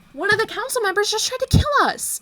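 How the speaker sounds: high, loud disbelief; shocked outrage. Acted. Party discovers a council traitor, shocked and outraged.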